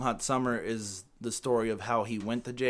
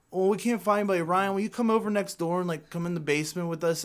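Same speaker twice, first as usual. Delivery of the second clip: put-on high-pitched pleading kid voice; twelve-year-old kid; 6 semitones higher than usual. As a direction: imitate a kid asking a neighbour over. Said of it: "they're, like, twelve years old".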